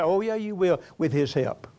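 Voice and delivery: preaching. Acted. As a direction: preach it out to the congregation, building. Preacher builds to exhortation.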